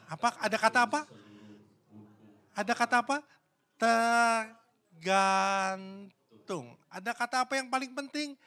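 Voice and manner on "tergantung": very slow, stretched-out Tergantung; drawn out. Acted. Preacher stressing the key word to the congregation, very slowly stretched. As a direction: draw out Tergantung syllable by syllable.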